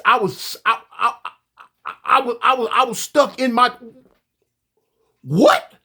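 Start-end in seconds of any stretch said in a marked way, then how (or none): 5.2-5.7 shocked. Real, not acted. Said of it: "I was shocked".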